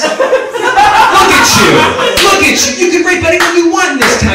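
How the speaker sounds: high, loud, hammed-up coy disbelief; mock flattered. Comic gushing at a stranger, hammed-up flattered. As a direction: squeal it like a bashful, flattered flirt. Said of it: "a little flattered".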